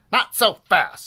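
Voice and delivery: high, loud challenge; villain's scream. A narrator's reading. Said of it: "screamed Doctor Diaper".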